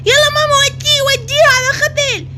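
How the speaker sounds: put-on a bratty boy bawling and tattling to mum; crying, shouting son. Son tattles on his sibling.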